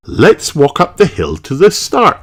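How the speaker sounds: a kind mum agreeing; Mummy Pig. A narrator's reading. Mummy Pig answering Peppa, lightly voiced mother.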